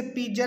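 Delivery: "पिज़्ज़ा" as pee-ja; mispronunciation. Shown as a demonstration. Teacher warning learners, wrong j pronunciation. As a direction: put a j in "पिज़्ज़ा". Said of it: "इसे पिज़्ज़ा नहीं".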